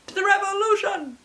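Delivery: high, loud mock battle cry; rallying cry. Book haul reader voicing rebels, hammed-up rally cry. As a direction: rouse the crowd toward revolution.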